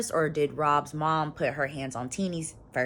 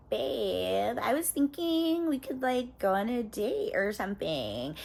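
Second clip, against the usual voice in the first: put-on sweet girlfriend; 5 semitones higher, 3 dB quieter than usual. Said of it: "Bianca's like".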